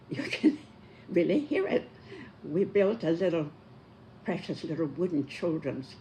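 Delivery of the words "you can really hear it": laughing. Real, not acted. Amused at carpenter ants chewing.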